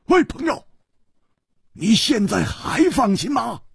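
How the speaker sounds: high, sharp stern demand; stern challenge. A narrator's reading. Worried onlooker challenges the hero over his fighting friend, stern voice. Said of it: "厉声说".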